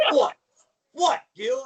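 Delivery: loud, high wrestler's question; Randy Savage. Retold or imitated. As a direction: punch out each "what" in an exaggerated wrestler voice. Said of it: "he's like".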